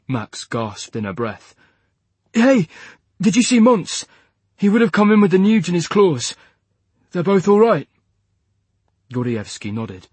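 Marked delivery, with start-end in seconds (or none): 2.3-7.9 loud, high and anxious; urgent loud questions. Acted. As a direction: press for news, raising the voice. Anxiously asking after squadmates.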